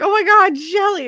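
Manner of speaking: imitating a thrilled friend, high-pitched; high, delighted cry of recognition; excited greeting. Principal hugging his friend before stunned staff, excited greeting.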